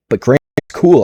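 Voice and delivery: ordinary talk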